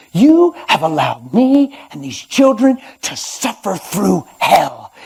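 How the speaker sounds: anguished cry. Retold or imitated. Wife crying out at God, loud and high. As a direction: cry out the accusation, slowly.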